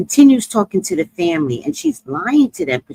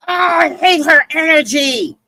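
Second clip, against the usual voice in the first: slow, loud, higher, spitting it out; angry shout; 6 semitones higher, 3 dB louder, slower than usual. Real, not acted. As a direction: vent real fury about her. Angry outburst about the woman.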